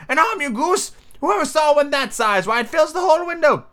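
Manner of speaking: very high-pitched indignant scolding; high-voiced master losing patience; exasperated Rabbit. A narrator's reading. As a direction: scold the foolish servant in a high character voice. Rabbit scolds his servant.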